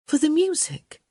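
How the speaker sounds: high, too-polite little question; overly polite query. A narrator's reading. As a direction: ask it a little too politely, pitched right up. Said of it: "perhaps a little too politely".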